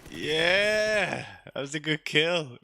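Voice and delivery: high, loud whoop of triumph; triumphant cheer. Real, not acted. Cheering a good kill in the game, excited and high.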